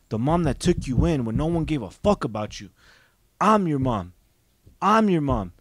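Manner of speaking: loud, emphatic retold scolding; mother's forceful rebuke. Mother berating her child for doubting her, forceful and loud. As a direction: act out a mother laying down the law.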